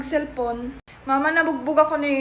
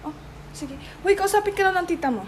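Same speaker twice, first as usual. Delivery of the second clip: ordinary talk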